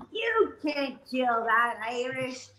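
high, drawn-out imitation of onlookers; mock crowd reassurance. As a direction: stretch it out high and emphatic, imitating others. Acting out everybody's reassurance at track.

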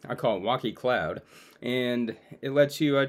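usual speaking voice

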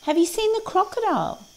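very high-pitched parrot voice; squeaky-high storybook question; inquiring little creatures. A narrator's reading. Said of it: "asked the parrot and the dragonfly".

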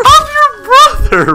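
high, loud, slow indignant cry; outraged disbelief. Real, not acted. Brother called him a loser, shocked and indignant yell.

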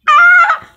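sensitive teeth wincing. Pretend. Teeth hurt, no teeth.

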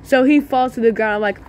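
usual speaking voice

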